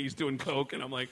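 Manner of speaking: ordinary delivery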